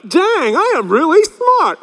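hammed-up smugness after saying something clever; smug self-praise. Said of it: "you're like".

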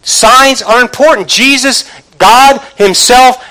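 high, forceful pulpit appeal; impassioned preaching. Real, not acted. Preacher urging the congregation to see God's love and signs, impassioned.

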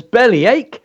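"Bellyache" high and exclaimed; performance-poem punch; emphatic dragon line. Shown as a demonstration. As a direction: call out the dragon's word. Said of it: "So the emphasis is on".

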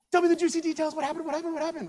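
high-pitched, breathless begging for gossip; eager excitement. Acted. Naomi greets Ruth back from Boaz, eagerly excited. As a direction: pester for the details, pitch way up.

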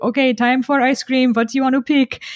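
a hint of a Persian dad's accent; dad at the ice cream shop, lightly Persian-accented. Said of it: "in his, like, Persian accent".

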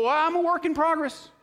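high, whiny mimicked excuse; put-on high excuse-maker; mock excuse. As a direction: mimic a feeble self-defence in a lifted voice.

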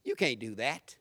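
low, dismissive doubting voice; dismissive naysayer. Acted. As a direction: drop low and discourage them. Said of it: "The other voice is".

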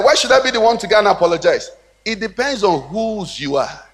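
normal talking voice

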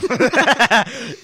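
high, loud burst of laughter. Real, not acted. Laughs at the possession idea.

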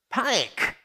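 high, alarmed cry of panic. Acted. Preacher voicing the disciples' panic. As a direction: sound the alarm in one word.

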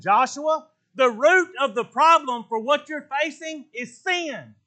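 God scolding Joshua to get up, loud; God's stern rebuke. Retold or imitated. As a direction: rebuke him like an impatient father. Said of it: "And the Lord said to Joshua".

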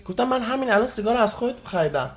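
ordinary talk, nothing marked

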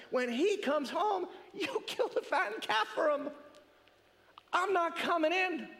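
hammed-up bitter complaint to his father; mock angry rant. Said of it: "he lets the father have it".

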